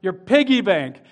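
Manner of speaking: ordinary delivery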